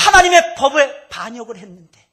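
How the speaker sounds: 하나님의 hit high and loud; loud pulpit cry; loud preaching. Acted. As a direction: shout the charge at the hall. Preacher cries out.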